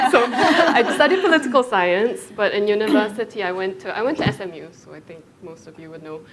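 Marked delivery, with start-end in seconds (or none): 0.1-2.2 higher and louder, still giggling; words spilling out mid-laugh. Real, not acted. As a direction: talk through the last of the laugh. Still laughing after an aside.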